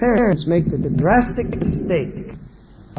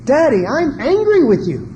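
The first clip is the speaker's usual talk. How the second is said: put-on child speaking up; 7 semitones higher, 5 dB louder than usual. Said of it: "a child should have a right to be able to say".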